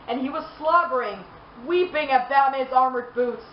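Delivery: usual speaking voice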